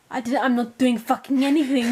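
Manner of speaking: a hint of an angry German; angry German guy. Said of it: "the German guy".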